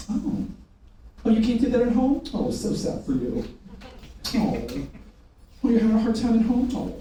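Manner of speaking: exaggerated cooing pity, sinking on each "oh"; mock sympathy. Trainer mocking customers' complaints, with fake sympathy. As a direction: pretend to feel so sorry for them.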